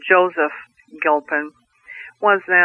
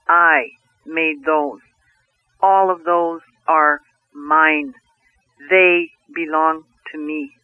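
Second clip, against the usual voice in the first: very slow and measured; spoken slowly, with weight; slow solemn declaration; slower than usual. Acted. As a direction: lay out each word as the Creator. Creator slows, claims creation.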